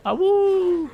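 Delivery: howling like a wolf pup. Pretend. Playful wolf howl while gaming.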